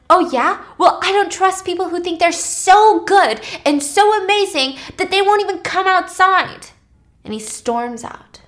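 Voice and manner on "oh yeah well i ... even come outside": high, loud acted comeback; angry retort. Virgil hitting back at Claire before storming out, angry retort. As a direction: fire back, loud and high, in a mock argument.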